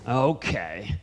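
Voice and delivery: soft, low, grudging okay; copping an attitude. Retold or imitated. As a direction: give in with quiet attitude. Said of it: "you're copping an attitude with me".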